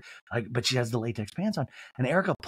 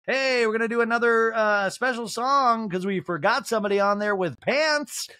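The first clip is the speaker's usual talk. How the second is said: raised, carrying announcer call; mock emcee announcement; 8 semitones higher, 3 dB louder than usual. Stage host adding a song, mock announcer voice. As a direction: announce it high and slowing down.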